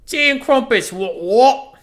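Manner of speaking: high, loud hammed-up British accent; mock British accent. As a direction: belt it out as an over-the-top Brit. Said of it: "Say tea and crumpets".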